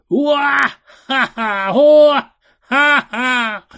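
high, very loud wicked guffaw; hammed-up evil wizard cackle in bursts; villain's laugh. A narrator's reading. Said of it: "and laughed".

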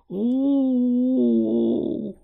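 put-on long, high child's wail of "oh"; little wail. A narrator's reading. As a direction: wail loudly in a small, terrified voice. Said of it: "wailed a little voice".

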